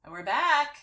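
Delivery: bright, loud, high welcome back; excited greeting. Real, not acted. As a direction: burst back in cheerfully. Hosts greeting after the reading.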